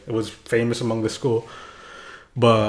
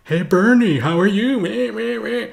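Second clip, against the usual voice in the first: much higher taunting voice; mocking bully; 11 semitones higher than usual. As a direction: tease the kid mockingly. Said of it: "He's calling me".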